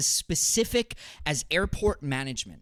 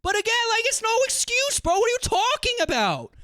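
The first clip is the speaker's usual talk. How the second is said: much higher, loud incredulous rant; exasperated yell; 14 semitones higher, 4 dB louder than usual. Real, not acted. Arguing with chat about airports, high incredulous yell. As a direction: disbelief shouted out.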